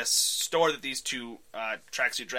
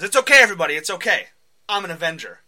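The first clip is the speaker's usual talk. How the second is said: loud reassuring call; 6 semitones higher, 12 dB louder, faster than usual. Acted. Clint reassuring the office.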